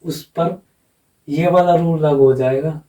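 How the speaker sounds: ordinary talk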